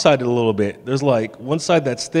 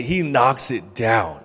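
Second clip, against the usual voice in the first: normal talking voice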